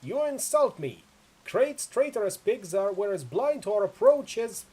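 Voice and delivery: slow, loud pirate shout over a storm; pirate captain hollering back through cupped hands; barked reply. Acted. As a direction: bellow it indignantly, high and drawn out. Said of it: "barks out his reply".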